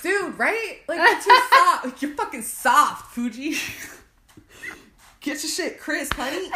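sassy mock scolding. Scolding the Fuji apple.

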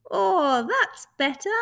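high, loud, relieved creature voice. Acted. Furry creature pops out of the box, high relieved voice.

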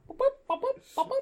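very high beeping boops; remote beeps. Retold or imitated. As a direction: chirp like a clicker. Imitating remote clicking sounds.

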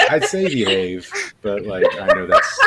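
chuckling through the words; laughing. Real, not acted. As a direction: laugh while talking. Laughing at own advice.

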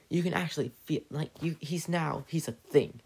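ordinary delivery

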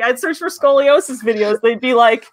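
normal talking voice

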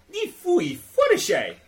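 high slow Simlish babble; Sims gibberish. Pretend. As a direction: babble like a Sim. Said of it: "That's the language in Sims".

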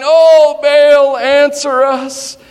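loud, high cry to a silent god; shouting. A narrator's reading. Prophets of Baal calling on their god, loud shout. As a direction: call out to Baal at full voice, the last words drawn out slow.

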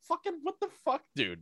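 high, soft strained disbelief; mock exasperation. Player to a sixteen-year-old teammate, acted high-pitched exasperation. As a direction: squeak out baffled outrage under your voice.

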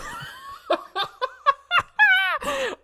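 long, high helpless laughter; real burst of laughter. Real, not acted. Remembering the flying guitar with his co-host, helpless high laughter. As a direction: crack up completely at the memory.

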